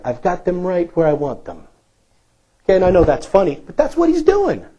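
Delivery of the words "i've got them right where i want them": a lightly voiced villain, a bit softer; scheming devil voice. The devil lurking behind them, a touch sinister. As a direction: play a hint of a gloating devil. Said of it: "he's back there".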